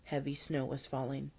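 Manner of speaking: ordinary delivery, nothing marked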